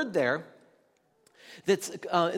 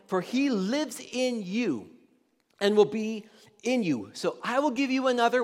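scripture reading; 2 semitones higher, 3 dB louder than usual. Acted. Reads scripture aloud.

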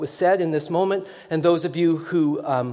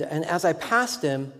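usual speaking voice

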